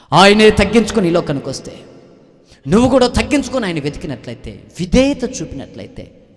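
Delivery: loud, urging the congregation to obey; fervent preaching. Acted. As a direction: press it home loudly. Preaching at full force. (గట్టిగా ప్రబోధించడం)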